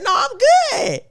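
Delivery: high, loud eager insistence; eager reply. Retold or imitated. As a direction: insist brightly that you're awake. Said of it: "I said".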